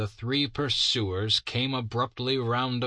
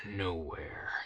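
a soft, much quieter, sighing Nowhere; disappointed sigh; 2 semitones lower, 7 dB quieter than usual. Acted. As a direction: let the word sink with disappointment. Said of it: "with a sigh of disappointment".

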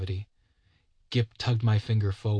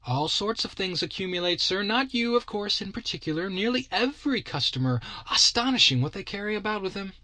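high, fussy shopkeeper voice; chatty magic-shop keeper, pitched up; shopman voice; 9 semitones higher than usual. A narrator's reading.

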